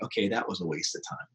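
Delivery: low, soft muttering to himself, fading to a whisper; quiet disappointed aside; deflated mutter. Retold or imitated. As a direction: grumble it under your breath as if the effort was wasted. Retelling his deflated inner thought.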